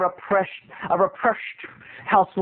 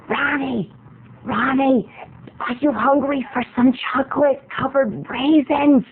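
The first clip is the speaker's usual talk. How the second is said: higher, sing-song mom voice calling dad; mom calling Ronnie; 4 semitones higher, slower than usual. Retold or imitated. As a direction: coax him sweetly like a mom in a cinema. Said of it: "my mom starts going".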